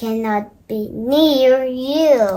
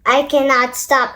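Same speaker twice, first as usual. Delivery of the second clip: ordinary delivery, nothing marked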